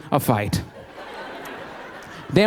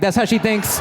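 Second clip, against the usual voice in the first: normal talking voice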